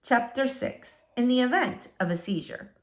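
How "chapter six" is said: ordinary delivery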